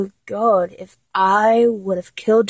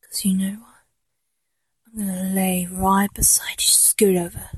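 close, soft whisper; intimate whisper; 6 dB quieter than usual. Acted. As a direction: whisper it right in the ear. Whispered command.

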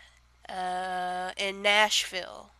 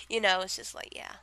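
ordinary talk

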